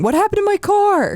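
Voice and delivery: high-pitched mock wail; hammed-up distressed dad voice; dad's dismay. Quoting the dad about his car, mock dismay. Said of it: "There's a definite dad thing".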